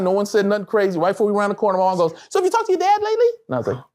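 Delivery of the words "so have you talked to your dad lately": high-pitched mock mom voice; high, chirpy imitation of a mother; voicing his mom. Mom's innocent question, retold.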